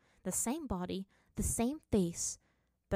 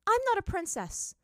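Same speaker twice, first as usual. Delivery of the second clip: high, loud rebuttal; firm; 13 semitones higher, 7 dB louder than usual. Acted. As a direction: reject the title flatly and forcefully. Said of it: "said Azula firmly".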